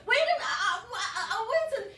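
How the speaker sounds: high, stammering protest; flustered panic. Retold or imitated. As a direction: sputter in mock panic. Trying to stop the mechanic.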